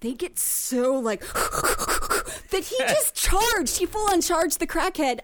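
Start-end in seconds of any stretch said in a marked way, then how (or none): 1.2-2.5 quick voiceless huffs; worked-up huffing. Retold or imitated. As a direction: pant rapidly like a riled-up dude. Imitates dudes getting worked up.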